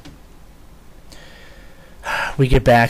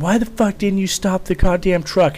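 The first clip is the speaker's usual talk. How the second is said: retold yelling; 9 semitones higher than usual. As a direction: imitate a staff sergeant chewing out the driver. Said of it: "so quit yelling at me".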